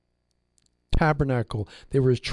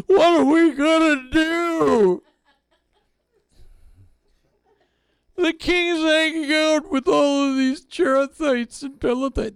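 mock panic; 12 semitones higher, 4 dB louder than usual. Scandalized at the king's Gentile bodyguards, hammed-up high wail. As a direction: shriek like a scandalized fusspot.

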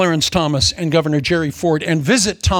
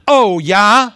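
loud, higher challenge; defiant retort; 5 semitones higher, 7 dB louder than usual. Acted. Preacher playing someone told a plain truth, loudly defiant. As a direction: throw it back loudly as a challenge.

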